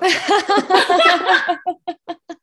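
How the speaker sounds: a loud relieved laugh, breaking the tension. Real, not acted. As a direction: let the laugh burst out. Laughs after moving story.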